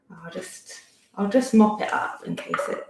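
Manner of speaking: usual speaking voice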